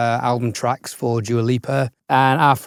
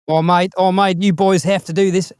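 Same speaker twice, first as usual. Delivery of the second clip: put-on Kiwi accent, higher pitch; 7 semitones higher, 3 dB louder than usual. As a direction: imitate a mate in a hammed-up Kiwi accent. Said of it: "that's more of a Kiwi".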